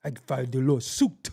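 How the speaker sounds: mock Belize accent. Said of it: "You act like you saying something different".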